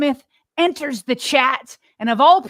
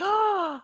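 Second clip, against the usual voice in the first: mimicked gasp of shock, high-pitched; 9 semitones higher, 3 dB quieter than usual. Host imitating a co-host's audible shock, sharp gasp. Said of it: "audibly is shocked and is like".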